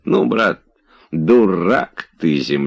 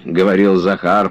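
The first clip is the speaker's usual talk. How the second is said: ordinary delivery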